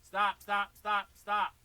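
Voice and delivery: sharp, loud call to stop. Real, not acted. Teacher stopping the student's wrong pattern, loud. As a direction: shout over the kit to halt him.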